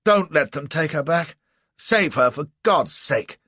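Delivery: high, loud urgent pleading; frantic plea for help; desperate plea. A narrator's reading. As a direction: beg them to save her. Pleading to save Cassie.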